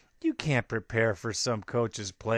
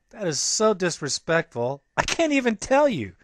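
indignant outburst; 7 semitones higher, 4 dB louder than usual. Real, not acted. Podcast host venting to fans about the Eagles, outraged. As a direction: voice jumping up, outraged.

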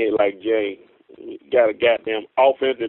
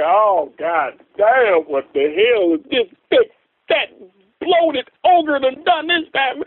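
drawn-out, higher-pitched outrage; exasperated rant; 11 semitones higher, slower than usual. Retold or imitated. Fan reliving news about the draft pick, exasperated. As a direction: climb into a retold outburst of dismay.

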